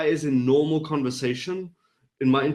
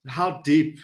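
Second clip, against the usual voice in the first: usual speaking voice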